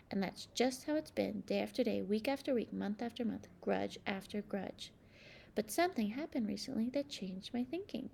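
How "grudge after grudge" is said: usual speaking voice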